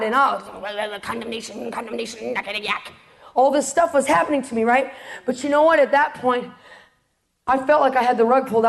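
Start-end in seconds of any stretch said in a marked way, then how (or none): 1.0-2.9 much softer, droning repetition; muttered accusation. Retold or imitated. Inner voice of shame.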